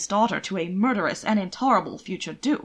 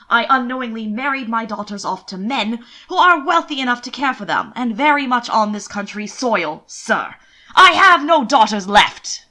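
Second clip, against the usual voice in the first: exasperated outburst; 8 dB louder than usual. A narrator's reading. Earl furious at the envoy.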